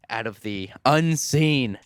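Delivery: slow, drawn-out suspenseful build, rising in pitch; storyteller's halting, climbing reveal; dramatic reveal. Real, not acted. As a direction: build up the monster word by word.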